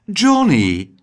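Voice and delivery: hoog, gerekt lokkend; vleiend. A narrator's reading. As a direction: vlei het kind met zijn naam. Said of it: "vleide ze". (poeslief roepen)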